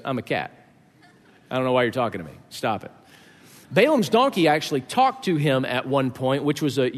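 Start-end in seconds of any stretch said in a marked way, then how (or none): 0.1-2.9 low, flat, unimpressed cat; deadpan cat voice. Retold or imitated. Cat tells its owner to stop talking, low and deadpan. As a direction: voice the cat, bored and dismissive, much lower and softer. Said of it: "your cat just looks at you and goes".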